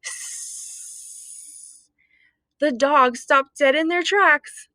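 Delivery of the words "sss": long unvoiced hiss; spooky graveyard hiss; horrible hiss. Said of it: "a horrible hissing sound".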